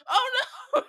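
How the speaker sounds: high, loud wail through a laugh; mock horror. Retold horror at rewatched scene.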